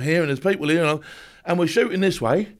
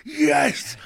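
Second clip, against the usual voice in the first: strained, psyched-up cry, rising high; imitating a scream at modest volume; hoarse-edged mock yell; retold scream; 10 semitones higher than usual. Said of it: "And I'm screaming, I'm going".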